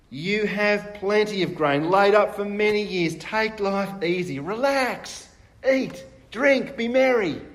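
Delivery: building from a slow smug drawl to a loud, high celebration; smug self-indulgence. Acted. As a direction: savour it, building to a gleeful high. Rich man tells himself relax.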